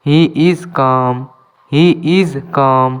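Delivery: slow, clear English for learners; careful dictation pace; slow teaching demo. Shown as a demonstration. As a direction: spell each word out slowly for students. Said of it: "এখানেও এলের উচ্চারণটি করা হয় না".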